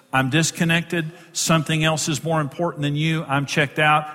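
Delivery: ordinary talk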